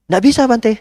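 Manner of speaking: high incredulous question; can't believe he refuses; imitating the lady, high; pushy lady. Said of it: "Bu, jam empat saya ndak bisa".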